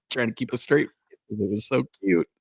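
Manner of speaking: normal talking voice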